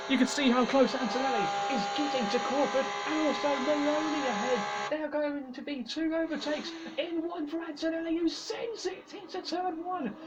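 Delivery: motorsport commentator. Acted. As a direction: build the tension of the battle. Excited live race call.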